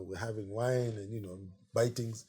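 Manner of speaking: ordinary delivery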